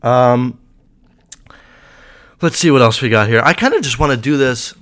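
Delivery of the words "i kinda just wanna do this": voice cracking. Real, not acted. A vlogger whose voice cracks mid-sentence. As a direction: pitch cracks mid-phrase. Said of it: "Oh, my voice cracked".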